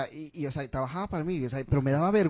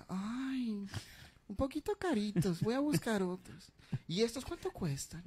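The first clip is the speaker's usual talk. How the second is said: effeminate customer whisper; 7 semitones higher, 5 dB quieter than usual. Retold or imitated. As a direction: ask the price coyly. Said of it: "medias afeminadas".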